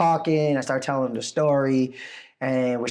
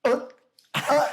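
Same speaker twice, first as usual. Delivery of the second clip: starstruck stammer; 12 semitones higher than usual. Retold or imitated. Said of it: "she couldn't talk".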